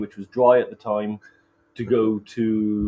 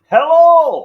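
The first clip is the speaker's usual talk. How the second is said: high, drawn-out loud greeting; loud hello; 14 dB louder than usual. Real, not acted. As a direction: belt a stretched hello to the viewers. Loud delayed greeting to viewers.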